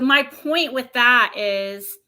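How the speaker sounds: ordinary talk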